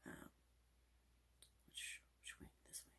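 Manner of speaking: ordinary talk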